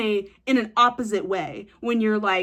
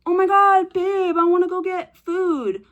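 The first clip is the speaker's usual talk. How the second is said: put-on high, whiny girlfriend begging for food; imitating a partner, pitched up and pleading; mock partner voice; 8 semitones higher, 4 dB louder than usual. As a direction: play the clingy partner wanting food.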